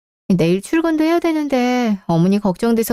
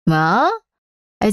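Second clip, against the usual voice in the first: usual speaking voice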